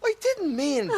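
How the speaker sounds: high, loud startled cry; shocked protest. Acted. Shocked that she suggests going to bed, crying out. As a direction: blurt it out, caught off guard.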